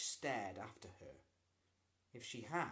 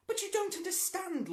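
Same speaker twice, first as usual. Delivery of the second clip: much higher and louder furious outburst; exploding; 11 dB louder than usual. A narrator's reading. Boy furious that his mother believes the lie, angry outburst. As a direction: burst out in anger. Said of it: "Lloyd exploded".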